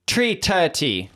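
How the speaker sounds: drawn-out imitation Jamaican lilt; Jamaican accent. Shown as a demonstration. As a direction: demonstrate a Jamaican accent that could pass for Irish. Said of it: "Irish or Jamaican?".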